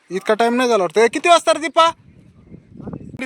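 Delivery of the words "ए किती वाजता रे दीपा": high, loud call across the house. Real, not acted. Father calling out to his wife Dipa, loud and high. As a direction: shout the question to someone far off.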